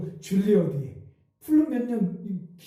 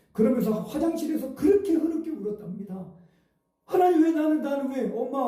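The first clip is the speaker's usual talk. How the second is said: higher, pleading cry to God; a boy sobbing out his complaint, raised in pitch; tearful protest; 5 semitones higher than usual. Retold or imitated. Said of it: "하나님께 막 따졌대요".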